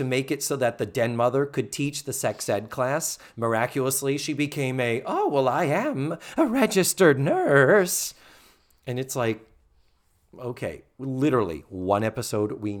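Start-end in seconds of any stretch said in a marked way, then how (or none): 5.1-8.1 high, slow, hammed-up announcement; mock a grandly drawn-out revelation; Missus Garrett. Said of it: "Missus Garrett".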